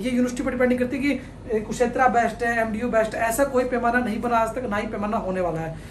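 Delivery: ordinary talk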